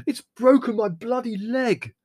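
slow, high, loud outraged yell; roaring in pain. A narrator's reading. Leslie hopping after the donkey's kick, roaring. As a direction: howl over the injury. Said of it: "roared Leslie".

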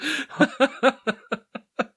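high falling laugh. Real, not acted. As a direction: let the laugh drop down. Laughing at wife's message.

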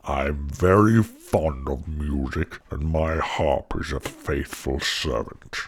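low, slow giant voice; deep, unhurried storybook giant. A narrator's reading. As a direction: rumble it like a self-satisfied ogre. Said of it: "said the giant".

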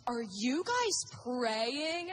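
drawn-out, delighted question; excited. Retold or imitated. Businesswoman discovering people praying by the elevator, slow excited amazement. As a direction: stretch it out in thrilled disbelief, imitating her. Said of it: "And she was so excited".

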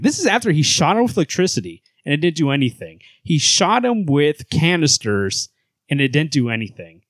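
usual speaking voice

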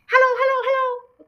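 high, loud squawky call; loud, clear parrot. Acted. Hidden parrot heard across the hall, loud and high. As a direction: sing out from another room in parrot voice. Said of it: "came the voice again".

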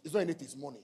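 normal talking voice

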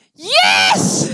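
hoge, harde vreugdekreet; juichende kreet. Retold or imitated. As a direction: schreeuw het uit van blijdschap. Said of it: "Ze begonnen weer te schreeuwen". (uitbundige juichkreet)

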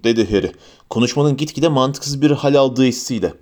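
ordinary delivery

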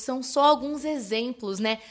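ordinary delivery

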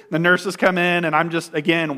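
normal talking voice

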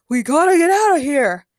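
loud, high-pitched call to flee; urgent shout. A narrator's reading. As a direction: shout it to get everyone moving. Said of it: "Ben shouted".

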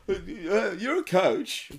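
laughing imitation of the pundit's trademark; laughing Robinson impression. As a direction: stretch "coach" out while cracking up. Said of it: "he always does that little gr".

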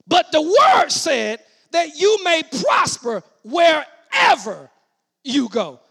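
slow, loud scripture proclamation; booming pulpit delivery; full-force preaching. Real, not acted. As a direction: shout the promise word by word.

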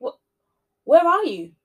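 loud anxious call; crying out. A narrator's reading. Cleaner calling the missing governor, loud and worried. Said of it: "she cried".

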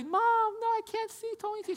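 put-on very high-pitched child's protest; imitated scared kid. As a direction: plead like a kid stuck up high. Scared kid protesting to his mom.

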